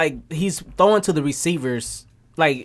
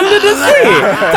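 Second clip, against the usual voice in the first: high, loud, slow Jamaican singsong; mock Jamaican accent; 6 dB louder, slower than usual. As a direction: stretch it out like a TV psychic. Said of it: "She was Miss Cleo and shit".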